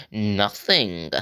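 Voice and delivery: entitled dismissive Karen "Nothing.". Retold or imitated. Girl dismissing the kid she stabbed, entitled Karen voice. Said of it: "in that Karen, entitled voice".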